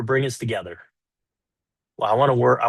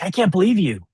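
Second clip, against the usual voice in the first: higher, exasperated; mock upset wife; 8 semitones higher than usual. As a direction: voice her disbelief. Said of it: "she might respond with something like".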